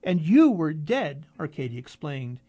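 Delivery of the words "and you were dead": emotional outburst. A narrator's reading. Arkady's outburst.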